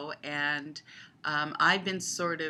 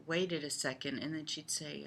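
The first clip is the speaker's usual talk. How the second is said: normal talking voice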